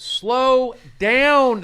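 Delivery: loud, high, drawn-out plea; exasperated command. Real, not acted. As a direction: rein in an overeager group, stretching the words. Host reining in eager guessers.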